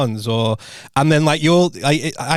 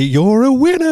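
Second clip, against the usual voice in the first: radio jingle voice; 12 semitones higher, 4 dB louder than usual. Retold or imitated. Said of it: "little jingle that it plays".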